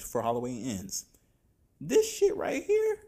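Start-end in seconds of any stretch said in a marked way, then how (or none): 1.8-3.0 can't believe what he's looking at; shocked, high, drawn-out disbelief; incredulous outburst. Real, not acted. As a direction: pitch jumps way up, louder and slow. Outraged at the Texas Chainsaw sequel.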